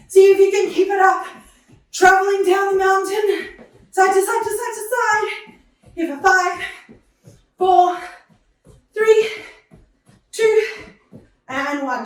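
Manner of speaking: aerobics instructor. Acted. Pumping up class during countdown.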